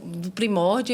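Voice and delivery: normal talking voice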